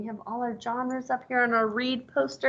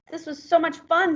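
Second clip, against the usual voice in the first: higher, bright duck voice; delighted duck; 8 semitones higher than usual. Acted. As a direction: gush it like a happy duck. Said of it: "said the duck".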